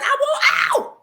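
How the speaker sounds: loud, high cry breaking into a laugh; desperate cry. Retold or imitated. Wife crying out to God, loud desperate shout. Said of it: "And I said".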